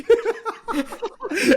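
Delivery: high squealing laugh; real burst of laughter. Real, not acted. Laughing at his own meme.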